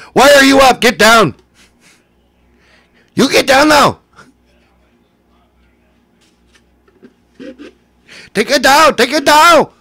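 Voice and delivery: high, loud mock yelling; hammed-up, high-pitched shouting; shouted orders. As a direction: bark frantic commands at full pitch. Acted shouted orders in impressions bit.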